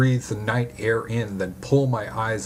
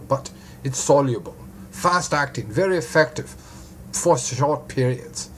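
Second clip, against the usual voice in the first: put-on German-accented doctor explaining a drug; broad German accent; 4 semitones higher than usual. A narrator's reading. Doctor explains the sedative to detective, German accent. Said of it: "he says in a broad German accent".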